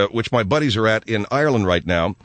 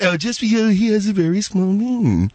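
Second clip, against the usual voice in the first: higher-pitched mock of the gossip's line; mocking repeat; 8 semitones higher than usual. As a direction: throw her words back in a high mocking voice. Host mimics her indelicate line aloud.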